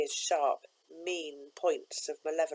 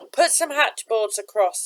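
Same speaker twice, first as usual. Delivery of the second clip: ordering; 5 semitones higher, 9 dB louder than usual. A narrator's reading. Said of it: "he ordered".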